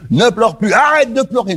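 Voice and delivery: rising, insistent commands; impatient orders. Retold or imitated. Preacher mocking a harsh comforter, insistent. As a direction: push it like a scolding parent. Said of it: "en disant".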